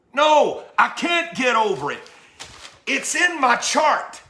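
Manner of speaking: usual speaking voice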